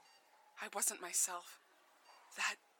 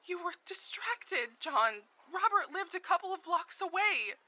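raised, accusing voice; heated, accusing; 7 semitones higher, 5 dB louder than usual. Acted. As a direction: throw the past back at him. Heated recollection to husband.

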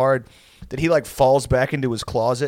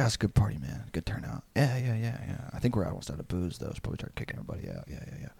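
low, soft, idle party chatter; quiet mock drunken chit-chat, fading away; imitating drunk small talk; 4 semitones lower, 11 dB quieter, faster than usual. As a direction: let it sink to a murmur, like tipsy guys making small talk. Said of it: "we're all just bullshit and drunk".

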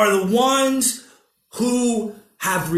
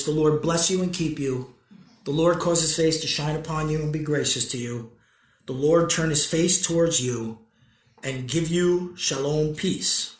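soft, hushed benediction; prayer; 6 semitones lower, 5 dB quieter than usual. Real, not acted. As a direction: bless them quietly and reverently. Priestly blessing and prayer.

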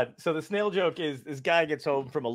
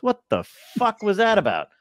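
indignant snail; 8 semitones higher, 4 dB louder than usual. Retold or imitated. Snail returns, ten years later.

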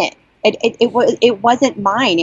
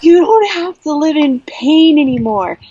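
excited call; 9 semitones higher than usual. Retold or imitated. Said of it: "and like".

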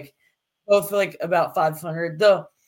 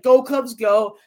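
louder, higher imitation of cheering fans; crowd cheer; 4 semitones higher, 6 dB louder, slower than usual. Fan recalling a game in August, imitating the crowd's cheer. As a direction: punch it out like a ballpark crowd. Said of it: "Everyone's like".

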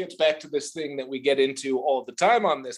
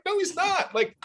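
mock protest; 8 semitones higher than usual. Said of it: "It's like".

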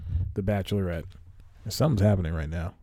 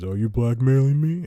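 a hammed-up, higher, louder villainess, half-joking; mock villain lady; 5 semitones higher, 6 dB louder than usual. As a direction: toss off a mock-outraged villain line. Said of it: "that's not how she sounds".

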